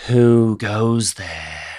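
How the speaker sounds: slow, drawn-out challenge; Buff Guy Abakin. Acted. Game master voices Abakin behind the door, slow challenge. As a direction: call it out slowly as a suspicious villain. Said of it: "in the voice of, um, Buff Guy Abakin".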